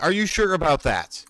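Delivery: a hint of wrestler bravado, teasing; John Cena impression. Teasing his players, a light mock John Cena taunt. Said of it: "wanna be like John Cena".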